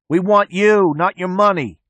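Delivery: shouted high and loud into the wind; yelling. A narrator's reading. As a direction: yell it out the car window. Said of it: "The mechanic yells out the driver's window".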